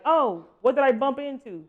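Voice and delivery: startled. Pretend. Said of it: "That way you're like".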